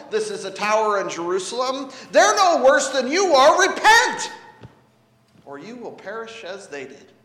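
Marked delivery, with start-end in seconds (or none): 2.1-4.3 loud, high preacher's shout; forceful cry building to a peak; Jesus exhorting. Acted. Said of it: "He says". 5.4-7.1 sudden soft drop after a shout; hushed warning. Acted.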